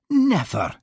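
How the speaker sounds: a high surprised "Never!"; exclaiming. A narrator's reading. Hamish reacts to gossip with a surprised exclamation. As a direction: jump up in pitch, shocked. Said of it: "exclaimed Hamish".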